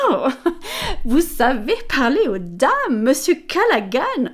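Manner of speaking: high, flattered, with a little laugh; coquettish lady. Acted. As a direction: play the flattered lady, giggling. Amelia flattered, coquettish reply. (dame coquette, flattée, avec un petit rire)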